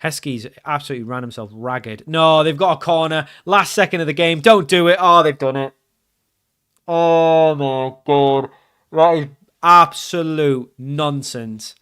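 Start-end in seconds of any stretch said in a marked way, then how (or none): 2.1-5.7 loud, rising alarm collapsing into dismay; panicked pleading. Real, not acted. Player watching a last-second corner against him, panicked. Late corner, opponents score. 6.9-11.8 loud, long drawn-out groaning words; outraged disbelief. Real, not acted. Player conceding in the last second, outraged.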